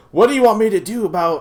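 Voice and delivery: high, loud, fast mocking foreman voice; mock the foreman brushing him off; dismissive foreman. Foreman dismissing his complaint, high loud fast mockery. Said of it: "He was like".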